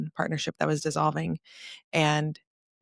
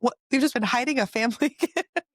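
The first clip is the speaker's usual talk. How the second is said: higher, laughing disbelief; mock outrage breaking into laughter; incredulous; 8 semitones higher than usual. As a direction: laugh through it, playfully shocked. Retelling the family's reveal of a gift.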